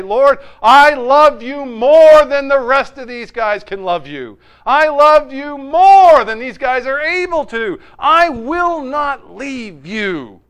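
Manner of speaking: loud, high, drawn-out declaration; macho bravado. Retold or imitated. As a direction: outdo everyone with a shouted vow of love. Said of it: "masculinity and machismo that he has".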